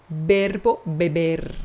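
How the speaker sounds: "verbo beber" slow with a tapped São Paulo R; teacher modelling the Spanish-like R. Shown as a demonstration. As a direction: tap the R in "verbo beber" slowly. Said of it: "Nós vamos adotar o da Paulista".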